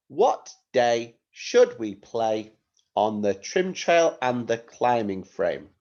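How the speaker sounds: slow, deliberate phrases to be repeated; measured teacher dictation, phrase by phrase; slow model reading. Shown as a demonstration. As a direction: say it for pupils to echo. Teacher modelling sentence for repetition.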